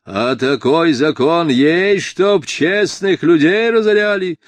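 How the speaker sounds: loud indignant roar with lifted pitch; barked. A narrator's reading. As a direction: bark it out as an irate official. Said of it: "рявкнул бургомистр".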